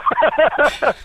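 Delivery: a high laugh bursting out; high bright laugh. Real, not acted. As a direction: laugh it off, delighted. Laughs after retold wow.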